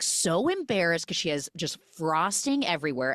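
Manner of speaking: ordinary delivery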